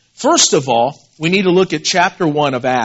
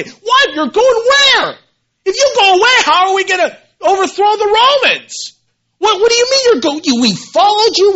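high, loud, frantic disciples pleading with Jesus; panicked disciples; 12 semitones higher, 5 dB louder than usual. Acted. Preacher voicing disciples, high alarmed pleading. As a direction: cry out like confused followers losing their leader.

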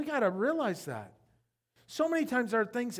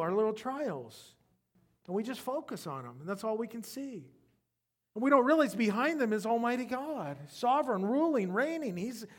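usual speaking voice

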